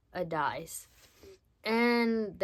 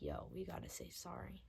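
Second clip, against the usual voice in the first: much lower, softer friend's voice; 15 dB quieter than usual. Retold or imitated. Friend saying they must apologise, low and soft. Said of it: "my unk friend was like".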